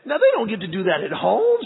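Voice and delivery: ordinary talk, nothing marked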